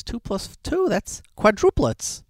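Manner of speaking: a chirpy lady pleased with her answer; high mock midwife. Lady from the hospital answering the little kid, high and slow.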